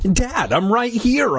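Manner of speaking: put-on high, frantic shriek of a scared boy. A narrator's reading. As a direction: cry out in panic, high-pitched. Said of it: "I shrieked".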